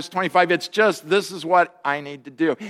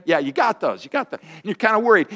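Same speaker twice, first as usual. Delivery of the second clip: ordinary talk, nothing marked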